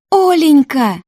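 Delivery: high, loud squealed name. A narrator's reading. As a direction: shriek it in joyful greeting. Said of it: "взвизгнула подруга". (радостный визг)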